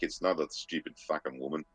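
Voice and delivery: plain reading voice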